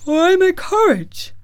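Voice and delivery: loud, very high lion's wail; lamenting lion. A narrator's reading. Cowardly Lion asks after the promised courage, lamenting. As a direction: cry out as a dismayed storybook lion.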